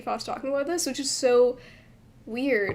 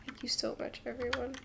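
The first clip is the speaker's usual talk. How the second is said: breathy whispered thank you; 14 dB quieter than usual. Shown as a demonstration. Translating a game line for viewers, whispered. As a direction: whisper the line softly to yourself. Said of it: "That's kind of like what it is in English".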